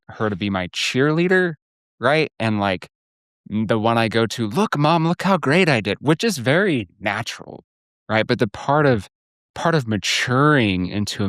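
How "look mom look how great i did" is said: higher, eager look-at-me voice; mock boyish boast, pitched up; boy seeking praise. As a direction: play a child showing off to mom.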